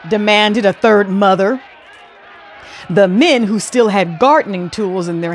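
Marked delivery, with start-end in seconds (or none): none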